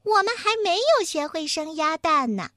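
high-pitched little mouse; high squeaky voice. A narrator's reading. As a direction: pipe up in a squeaky voice. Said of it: "鼠老二尖着嗓门说".

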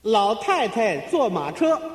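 slow, measured delivery of an answer; slow announcement. Shown as a demonstration. Partner reveals the riddle's answer to the loser, slowly and deliberately.

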